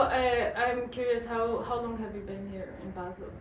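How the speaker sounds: ordinary talk, nothing marked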